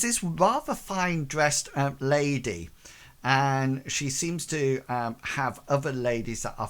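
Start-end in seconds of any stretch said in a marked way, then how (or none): none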